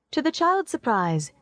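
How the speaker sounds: ordinary delivery, nothing marked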